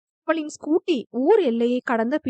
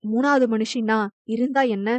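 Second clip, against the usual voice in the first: usual speaking voice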